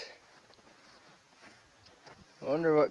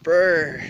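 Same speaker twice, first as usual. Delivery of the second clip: a loud brr from the cold; shivering brr; 2 semitones higher, 8 dB louder than usual. Real, not acted. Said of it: "so cold out here".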